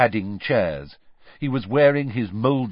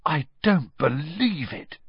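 slow, stunned disbelief, pitched up; 6 semitones higher than usual. A narrator's reading. Ron reacting to Krum's arrival, stunned. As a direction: Ron can't believe his eyes. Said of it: "Ron said in a stunned voice".

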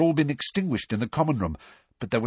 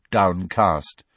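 plain reading voice

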